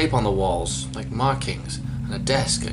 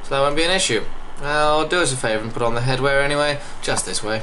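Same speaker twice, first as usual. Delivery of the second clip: higher, louder English usher; English accent; 4 semitones higher, 4 dB louder than usual. Acted. Guide insisting visitors put on headwear, English accent. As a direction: steer them politely in an English voice. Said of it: "I don't know why he's English".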